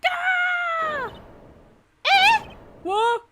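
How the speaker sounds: very high startled cry; real shock at a reveal; shocked yelp. Real, not acted. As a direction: yelp in disbelief. Shocked yelps at twist.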